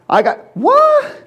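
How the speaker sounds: high, slow outraged squeal; mock disbelief. Preacher mocking people who refuse to accept, high squeal.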